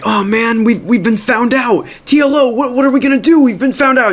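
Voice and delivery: high-pitched mock panic; freaking out. [name] to T L O after being caught smoking, freaking out. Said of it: "[name]'s freaking out".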